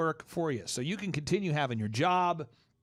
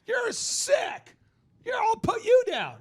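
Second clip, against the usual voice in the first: much higher, louder mock-furious shriek; outraged spouse; 5 dB louder, slower than usual. Wife yelling at husband who wants to put down the dog, high mock-furious shriek. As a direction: shriek it like a spouse defending the husky.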